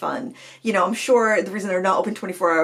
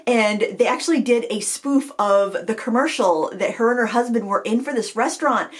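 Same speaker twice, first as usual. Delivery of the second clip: usual speaking voice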